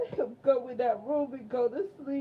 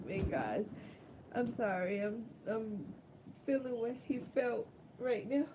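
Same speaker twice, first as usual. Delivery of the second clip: ordinary talk, nothing marked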